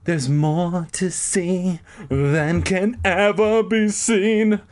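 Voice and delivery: high, slow grandiose recital; grand dramatic reading. Retold or imitated. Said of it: "as if I was like".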